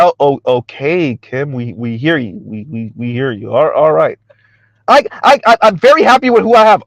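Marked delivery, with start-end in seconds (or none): 4.9-6.8 mock boast in a raised voice; Kim Foster. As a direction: imitate a self-satisfied woman, lightly pitched up.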